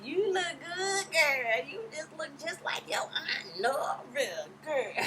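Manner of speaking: high, slow, weepy praise; teary gushing auntie. Retold or imitated. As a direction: gush like a tearful happy aunt. Nora gushing at Candy.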